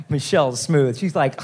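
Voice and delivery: usual speaking voice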